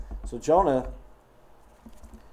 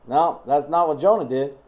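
normal talking voice

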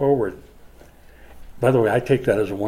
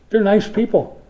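"They're nice people" said higher and louder, gushing; mock sweet praise; 8 semitones higher, 5 dB louder than usual. Pastor mocking shallow praise of nice people, gushing. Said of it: "Doesn't that sound like a good funeral sermon?".

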